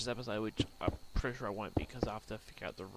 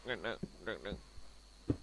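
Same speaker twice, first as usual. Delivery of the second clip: usual speaking voice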